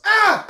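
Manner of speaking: short, loud high-pitched yell; mock scream of terror; sudden high yelp; startled yell. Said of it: "just yells".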